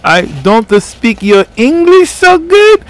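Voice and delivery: high, slow mock foreign accent; high slow mock broken English. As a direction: exaggerate a halting foreign speaker. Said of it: "these kinda broken accents".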